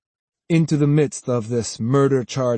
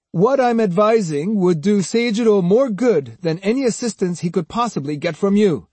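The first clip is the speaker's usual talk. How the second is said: higher-pitched, self-assured character voice; Kojiro advising; 8 semitones higher, 4 dB louder than usual. A narrator's reading. Swordsman offering advice to angry men, self-assured. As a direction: advise them as if you know best.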